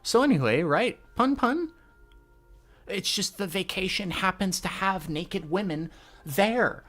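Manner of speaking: a hint of a bossy girl, then a flustered boy making excuses; lightly voiced manga characters. Voicing the manga characters' dialogue.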